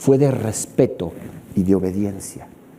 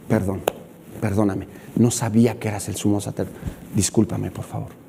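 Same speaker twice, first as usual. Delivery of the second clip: lower, softer contrite apology; sheepish apology; 7 semitones lower, 6 dB quieter than usual. Acted. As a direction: humbly beg pardon.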